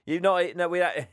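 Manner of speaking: ordinary talk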